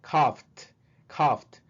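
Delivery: "coughed" ending in a sharp T. Shown as a demonstration. Teacher repeating the word for students. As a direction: land the final T of "coughed". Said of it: "La T".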